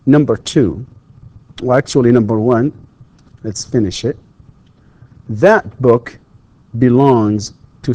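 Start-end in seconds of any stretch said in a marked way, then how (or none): none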